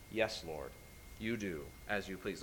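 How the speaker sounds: low, very soft yielding voice; bow to it quietly, low and hushed; humble submission. Acted. Preacher models answering the Lord, humble and hushed. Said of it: "We ought to be humble".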